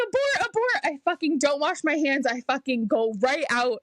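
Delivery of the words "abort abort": very high, urgent call to abort; panicked alarm. Retold or imitated. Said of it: "I'm like".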